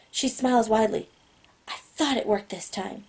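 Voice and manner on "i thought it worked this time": softly hushed, pleased; excited whisper. A narrator's reading. Girl whose mind message worked, whispering excitedly. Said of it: "she whispers excitedly".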